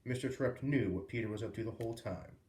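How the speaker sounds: plain reading voice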